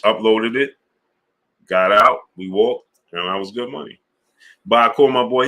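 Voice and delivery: ordinary talk, nothing marked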